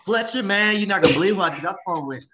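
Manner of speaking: high, loud and rushed; fast-talking Southern excitement; excited fast talker. Retold or imitated. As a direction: blurt the news out fast, can't contain it. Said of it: "he gets really excited".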